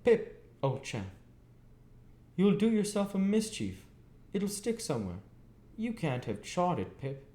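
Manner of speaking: high, earnest blacksmith voice, gently scolding; a kindly smith's high, earnest warning; Joe in serious remonstrance. A narrator's reading. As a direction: plead with the boy, high and worried. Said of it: "in very serious remonstrance".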